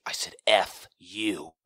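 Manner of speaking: loud, slow defiant shout, imitating a kid; imitated defiant yell. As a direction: shout it back, drawing out each word. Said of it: "And he shouts out".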